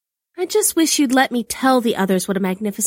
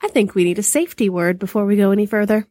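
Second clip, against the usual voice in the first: quick, higher-pitched pretend worry; playfully fretting wife; mock distress; 4 semitones higher, faster than usual. A narrator's reading. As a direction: rush it out in feigned alarm. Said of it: "said in mock distress".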